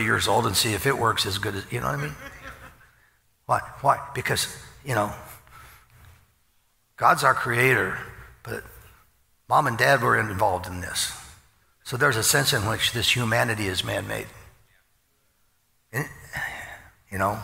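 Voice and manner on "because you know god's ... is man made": ordinary delivery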